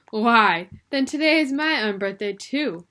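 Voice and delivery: Alice happily claiming her own unbirthday; happy announcing. A narrator's reading. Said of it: "she announced happily".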